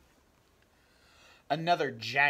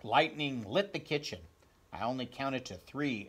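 plain reading voice

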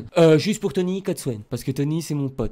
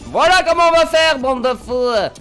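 high, loud, drawn-out last words; defiant shouting; 8 semitones higher, 7 dB louder, slower than usual. Real, not acted. Streamer telling off his viewers, defiant shout. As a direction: shout at the crowd, stretching the end.

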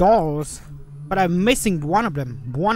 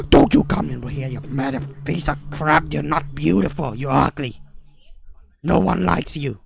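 low trash talk; 7 semitones lower than usual. Real, not acted. Insulting the monster, low voice.

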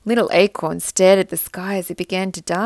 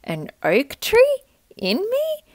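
rising high in disbelief; can't believe it, voice climbing; doubtful little acorn; 13 semitones higher, slower than usual. A narrator's reading. Little acorn doubting he holds an oak, high disbelief. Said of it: "would look at himself and say".

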